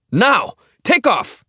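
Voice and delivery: sharp high scream; frantic scream at the pilot. Acted. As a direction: bark the destination as a scream. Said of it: "Jelak screamed every word".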